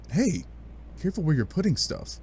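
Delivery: plain reading voice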